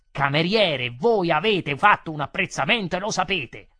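loud, forceful reproach; angry accusation. Acted. Customer accusing the waiter who denies it, loud and angry. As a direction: hammer the charge home loudly.

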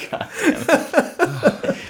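high laughing slow curse; delirious laughing. Real, not acted. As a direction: laugh helplessly through the words. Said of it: "The heat has made me delirious".

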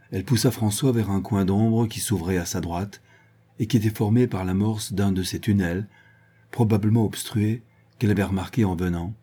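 ordinary delivery, nothing marked

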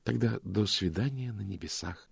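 a quiet near-whisper. A narrator's reading. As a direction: murmur it close. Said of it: "прошептала она".

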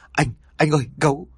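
breathless frightened call; panicked. A narrator's reading. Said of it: "vì quá hoảng sợ".

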